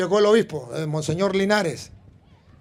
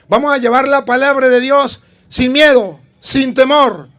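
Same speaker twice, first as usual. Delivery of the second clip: louder fervent sermon lines; preaching; 2 semitones higher, 7 dB louder than usual. Acted. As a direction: rouse the congregation to evangelize. Preaching conclusion.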